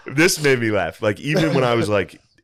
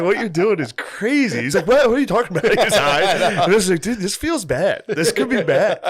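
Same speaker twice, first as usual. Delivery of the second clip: laughing; 10 semitones higher, 4 dB louder than usual. Retold or imitated. Laughing retelling burger scene.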